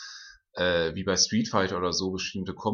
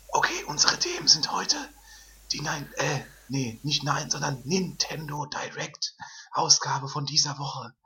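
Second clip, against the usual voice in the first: whispering; 5 semitones higher than usual. Acted. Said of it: "in flüsternder Form".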